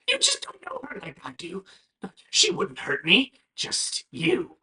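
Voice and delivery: fast, breathy whispered warning; creepy whisper. Acted. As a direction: hiss it like a devoted little freak. Bird defends his sister.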